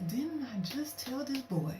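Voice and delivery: high, exasperated mutter to herself; exasperated aside. Retold or imitated. Recalling his repeated messages, an exasperated high aside. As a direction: roll your eyes at him.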